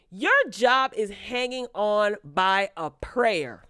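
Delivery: slow, deliberate warning; pointed slow jab. Real, not acted. Warning the character Sabrina, slow and pointed. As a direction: spell out her peril.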